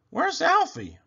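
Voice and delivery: a hint of worried mum; Mama. Mama voiced asking.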